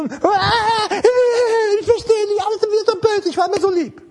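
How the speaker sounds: mocking pious bawling, high and plaintive; pious whining. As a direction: whine like a self-pitying believer. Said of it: "Das ist frommes Geplärre".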